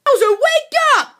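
high, drawn-out yell to rouse someone; urgent high-pitched call; wake-up shout. Acted. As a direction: shout the sleeper awake. Waking the sleeper.

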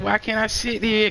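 high, slow pleading whine; mocking whiny voice. Friend retelling a guy begging for a seat, high whine. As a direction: whine it like a kid who wants the seat. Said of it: "He said".

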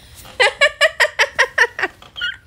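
loud high burst of laughter. Real, not acted. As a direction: crack up at the mistake. Laughs at the misread username.

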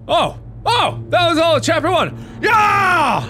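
high, excited victory cheer; elated. Real, not acted. Player finishing chapter one, elated. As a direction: cheer in amazed triumph.

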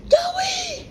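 very high shrieking hello; high squeaky greeting shouted at the owner; excited shout. Acted. Stranger shouting name.

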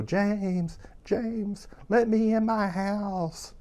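high, drawn-out diva call through a door; Norma Desmond. Retold or imitated. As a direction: wail it slowly like a faded film star. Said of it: "strictly Norma Desmond from Sunset Boulevard".

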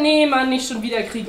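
fed-up interjection; interrupting. A narrator's reading. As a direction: cut in, exasperated. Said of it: "unterbrach der Eisbär".

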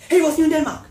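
rushing it. Acted. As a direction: blurt it out like a panicking actor. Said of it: "then I started rushing it".

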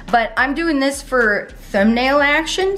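ordinary delivery

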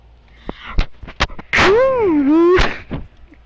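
bursting out laughing; big loud cackle. Real, not acted. Laughing at the age insult.

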